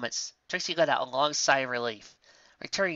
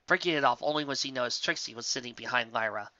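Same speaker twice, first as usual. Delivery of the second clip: plain reading voice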